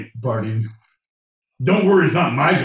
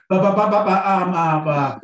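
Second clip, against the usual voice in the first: panicked sputtering babble; 5 semitones higher than usual. Retold or imitated. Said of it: "who's like".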